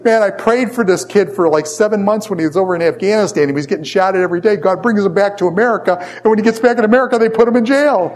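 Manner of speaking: high-pitched, worked-up protest; exasperated rant, re-enacted at high pitch; freaking out. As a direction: retell your panicked outburst, voice pitched right up. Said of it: "I'm freaking out and going down".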